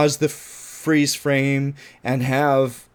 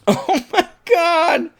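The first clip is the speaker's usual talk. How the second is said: high, slow, laughing oh my God; laughing disbelief; 3 dB louder, much slower than usual. Real, not acted. Reviewer laughing in disbelief at the character.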